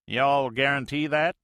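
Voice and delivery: folksy Mississippi skipper talk, loud; Mississippi accent. Acted. Major answers the Navy plane in Mississippi accent, loud and folksy. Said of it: "The major's Mississippi accent".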